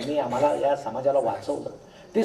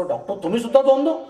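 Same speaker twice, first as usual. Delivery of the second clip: high, loud alarmed cry; mock yell; 9 semitones higher, 5 dB louder than usual. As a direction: yell it at the doctor in shock. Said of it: "हा गेल्याबरोबर ओरडतो". (डॉक्टरकडे बघून घाबरून मोठ्यानं ओरडणं)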